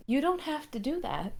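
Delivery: quiet. Acted. Leon quietly deflecting.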